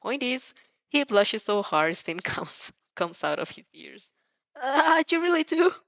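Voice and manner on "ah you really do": flustered, higher and louder. A narrator's reading. Blushing Izuku asking if she means it, flustered and high. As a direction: squeak it out, caught off guard.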